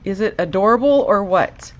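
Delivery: usual speaking voice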